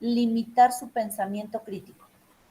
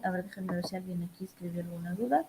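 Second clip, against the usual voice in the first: quick, much softer murmur; absent-minded aside; 3 semitones lower, 12 dB quieter, faster than usual. Real, not acted. Teacher checking the chat for students' questions, quiet aside. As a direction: say it fast and low-volume, half to yourself.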